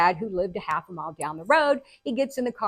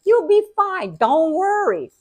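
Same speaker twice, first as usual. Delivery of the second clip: high, loud, breezy brush-off; hammed-up unworried father; dismissive dad; 10 semitones higher, 8 dB louder than usual. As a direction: insist loudly that it's nothing. Dad brushing off her bleeding.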